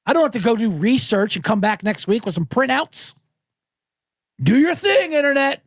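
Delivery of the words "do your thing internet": higher, pumped-up call to the crowd; sarcastic call urging the internet to pile on; mock rallying cry. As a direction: egg on the online mob.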